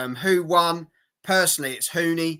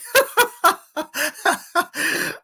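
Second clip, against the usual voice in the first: high burst of laughter; loud high laugh; 13 semitones higher, 4 dB louder than usual. Real, not acted. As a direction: cackle out loud. Laughing at the comment.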